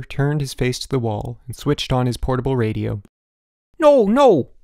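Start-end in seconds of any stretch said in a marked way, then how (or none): 3.8-4.5 high, loud alarmed protest; cried out. A narrator's reading. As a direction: shout in frantic objection. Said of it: "Elmer cried".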